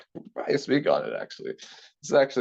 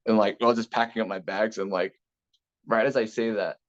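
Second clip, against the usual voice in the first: ordinary talk, nothing marked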